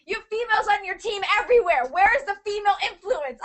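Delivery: high-pitched, heated rant; getting angry. Real, not acted. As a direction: rant in exasperation, pitch climbing. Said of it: "I'm getting so angry".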